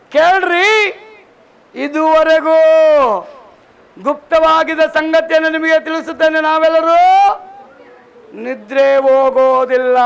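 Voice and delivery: loud, high proclamation, slowing on the last words; thundering the apostle's words to the congregation; preaching scripture. Real, not acted. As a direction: call out the verse like a herald. Repeating the verse loudly.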